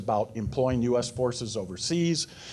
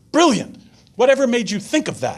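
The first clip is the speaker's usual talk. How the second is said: much higher and louder, thrilled praise; delighted praise; 9 semitones higher, 4 dB louder than usual. Retold or imitated. Battalion commander praising the sergeant, delighted and loud. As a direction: gush over the sergeant's clever idea.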